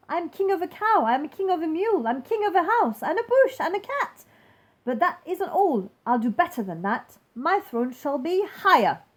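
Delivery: swagger up through each title; king lording it over Mac; gloating king. Acted. King boasting again.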